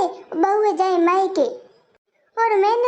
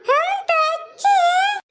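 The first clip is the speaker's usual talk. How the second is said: put-on high, slow child's voice; little child voice; 9 semitones higher, slower than usual. Children answer aunt's greeting, high slow little-child voice. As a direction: answer sweetly like a small kid.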